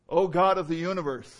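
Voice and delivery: big, loud, reverent call upward; grand booming prayer. Retold or imitated. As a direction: boom it out like a cantor to a distant God. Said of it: "And his prayer was".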